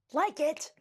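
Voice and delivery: loud, high outraged bellow; scandalized neighbor bellowing. A narrator's reading. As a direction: blast the words back at her. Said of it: "bellowed Missus McSnoot".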